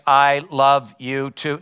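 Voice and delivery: slow, even-pitched robot monotone; drugged-robot reply, word by word; robotic voice. Acted. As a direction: answer mechanically, like a brainwashed robot. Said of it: "in a very robotic voice".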